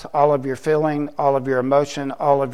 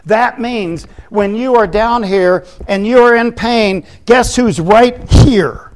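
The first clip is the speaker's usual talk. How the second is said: raised, emphatic pulpit voice; forceful preaching; 5 semitones higher, 5 dB louder than usual. Real, not acted. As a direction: preach it with conviction, stretching key words.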